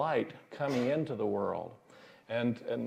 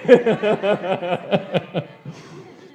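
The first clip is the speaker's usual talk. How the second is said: loud, high burst of laughter; laughing at his own bad joke; hearty laugh; 6 semitones higher, 11 dB louder than usual. Real, not acted. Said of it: "That was a groaner".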